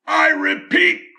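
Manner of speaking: high, loud and slow; cried out. Acted. Lorax insisting to the Once-ler, high loud cry. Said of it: "cried the Lorax".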